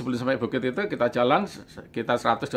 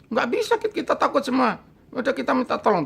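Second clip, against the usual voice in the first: high frightened plea; a lightly voiced scared friend; mock scared; 9 semitones higher than usual. Scared friends tell narrator they cannot, high frightened voice.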